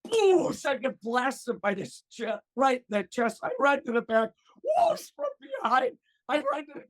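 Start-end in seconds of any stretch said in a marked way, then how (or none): 0.1-0.7 high, loud jet blast noise; imitated water jet whoosh. Imitating the cleaning jets. 4.6-5.1 imitated water jet whoosh. Imitating the cleaning jets.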